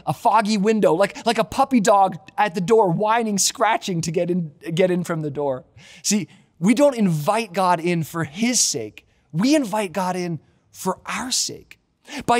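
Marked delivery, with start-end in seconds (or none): none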